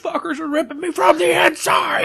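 high, loud agonized yell; screaming in pain. Retold or imitated. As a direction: scream like you're torn apart. Quoting a monster victim.

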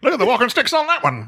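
high, quick vintage patter; old-timey wise guy. Acted. Host plays an old-time ogler, old-timey voice. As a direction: wisecrack like an old movie talker.

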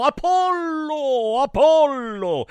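high, long-drawn mock yell; theatrical high wail of a name; endless cry. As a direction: stretch the name out in a high call. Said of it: "gridai all'infinito".

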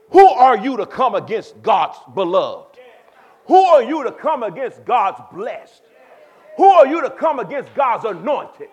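loud, slow, driving challenge; preaching at full force; forceful preaching. Acted. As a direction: hammer each who are you. Preacher voicing David's bold faith.

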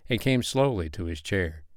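plain reading voice

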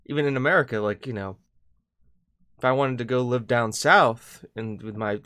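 normal talking voice

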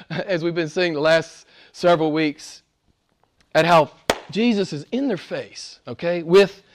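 ordinary talk, nothing marked

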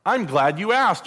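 high, slow and brightly eager, as if pleased to be asked; preacher's own jokey line, delivered high and slow; cheery joke Jesus. Pretend. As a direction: play it like a cheerful host welcoming the question. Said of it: "No, sorry, that's [name]".

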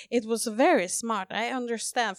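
mock-tipsy, over-deliberate praise; tipsy re-enactment. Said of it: "man er jo litt full da".

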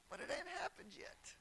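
high, drop from the shout; doubting. Acted. Preacher voices believer still waiting on God, doubting. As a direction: let the confidence drain away.

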